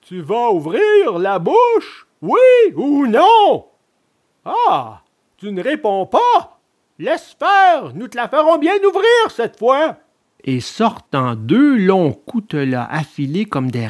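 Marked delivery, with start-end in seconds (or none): 0.0-3.7 slow, drawn-out high threat; menacing assassin. A narrator's reading. Assassins ordering the puppet to open his mouth, slow menace. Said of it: "lui demandèrent les assassins". 4.4-10.0 high, menacing villain voice; threatening assassin. A narrator's reading. Assassins to the silent puppet, menacing.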